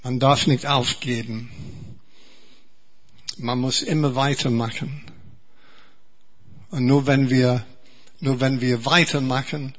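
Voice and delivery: ordinary talk, nothing marked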